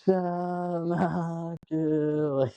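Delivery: slow, high, drawn-out preacher voice; mock solemn commandment. Joking about the lovers, as a slow, high mock commandment. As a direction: intone it like a hammed-up biblical decree.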